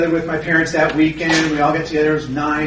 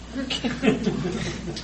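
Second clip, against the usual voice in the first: 1 amused laugh; 3 semitones higher, 7 dB quieter than usual. Real, not acted. Laughs at own resentment.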